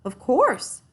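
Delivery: cheerful yes from the builder; builder's reply. A narrator's reading. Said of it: "replied the builder".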